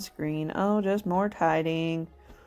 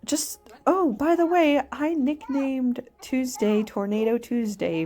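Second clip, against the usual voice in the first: imitating mom; 8 semitones higher, 3 dB louder than usual. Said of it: "she's like".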